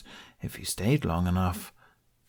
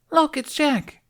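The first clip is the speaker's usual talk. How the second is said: put-on very high child's shout; child calling out; 4 dB louder, slower than usual. A narrator's reading. A kid spotting Jack across the square, calling. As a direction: squeal like an excited kid.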